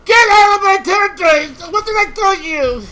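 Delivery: furious shout at the invaders; angry yell. Real, not acted. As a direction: shout them out of your territory. Yelling at invading units.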